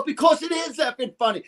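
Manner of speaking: high, shouted insistence on effing funny; emphatic shout. Retold or imitated. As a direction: belt it out to make the point. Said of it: "I go".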